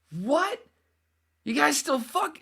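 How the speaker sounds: very high, loud squawk of disbelief; shocked outburst. Retold or imitated. Acting out his shock to the kids, high shocked yell. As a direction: yell it in a high comic screech.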